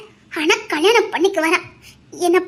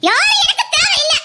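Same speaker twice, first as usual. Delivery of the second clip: defiant outburst; 10 semitones higher than usual. Acted. Aadhira rejecting the whole world, defiant raised cry. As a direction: throw the words out at everyone.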